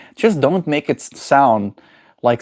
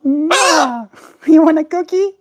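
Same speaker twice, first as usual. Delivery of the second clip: very high kissy pet voice; talking to a pet bird; 3 dB louder than usual. Real, not acted. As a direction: kiss and coo at the bird. Kissing bird, offering cookie.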